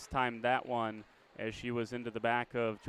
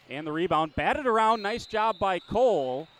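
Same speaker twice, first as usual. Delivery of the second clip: loud, higher, drawn out as the ball is batted around; excited call; 5 semitones higher, 8 dB louder than usual. Real, not acted. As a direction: ride the frenzy on the boards. Ferocious rebound scramble.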